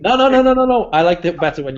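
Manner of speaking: high, loud repeated no; loud protest. Real, not acted. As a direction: shout the nos in protest. Protesting friend's on-screen pose.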